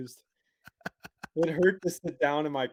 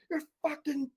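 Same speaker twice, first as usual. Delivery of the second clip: pitched way up, outraged at the owner; high indignant yell; 13 semitones higher than usual. Retold or imitated. Yelling up at the teenage girl about her dog, high and outraged. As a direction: shout it up at the window, stung and furious.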